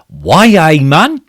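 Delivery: a minister badly faking Jeff's Geordie accent; mock Geordie greeting to a Sunderland viewer. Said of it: "I've been practicing your accent".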